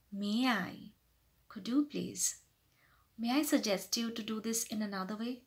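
soft, tactful suggestion; professional, polite. Shown as a demonstration. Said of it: "Like that, be more professional".

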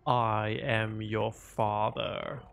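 deep slow demon voice effect; slow, menacing Darth Vader line; demonic layered voice. Pretend. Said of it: "It's supposed to be a voiceover effect".

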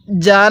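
জান with a long hummed n; gunnah nasal hold. Shown as a demonstration. Teacher shows where gunnah is needed, nasal held জান. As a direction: hold the n of জান through the nose. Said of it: "এখানে আমরা গুনাহ করব".

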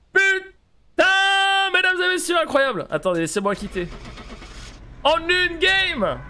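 high loud victory shout; triumphant shout. Real, not acted. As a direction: announce the win to everyone. Crie sa victoire. (cri de victoire)

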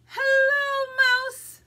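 high, loud storybook duck hailing a friend; calling out a greeting. Acted. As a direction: sing out a big hello as the duck. Duck calls to Mouse in story.

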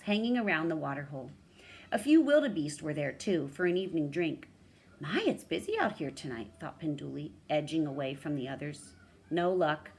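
animated picture-book narrator; story narration. A narrator's reading. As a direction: read it aloud like a bedtime tale for a child. Reads story to child.

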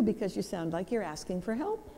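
soft, with "help" lifted like a question; questioning upspeak. Shown as a demonstration. Speaker showing why men offer advice, questioning rise on "help". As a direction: let the pitch rise on "help" as if asking. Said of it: "you sound like you're asking a question".

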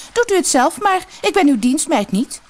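high, indignant snapped retort; snapping. A narrator's reading. Rude girl refusing to serve a lady, snappish.

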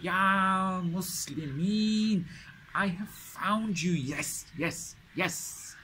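high, calling out across the distance; a captive spotting rescue, crying out in relief; joyful shout from afar. Retold or imitated. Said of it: "So he's shouting this out in the distance".

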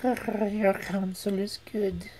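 slow whisper with a smile; sly whisper. Acted. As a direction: whisper it, smiling to herself. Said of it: "smiling to itself".

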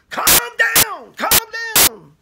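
very high, stretched-out catchphrase; Paul Begley impression. Minister mimicking Paul Begley's opening, high and exaggerated. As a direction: exclaim it way up high, hammed-up. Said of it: "that's how it sounds".

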